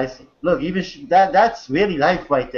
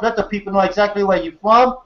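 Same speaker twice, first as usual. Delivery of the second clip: normal talking voice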